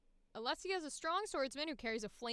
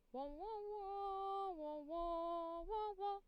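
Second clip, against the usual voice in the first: vocal warm-up wahs; 8 semitones higher, much slower than usual. Shown as a demonstration.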